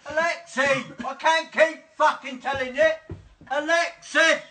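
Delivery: man at the end of his tether screaming at the speaker; furious shout. Real, not acted. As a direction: lose it at the machine. Yelling at smart speaker.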